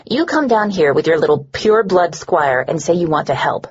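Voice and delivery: ordinary delivery, nothing marked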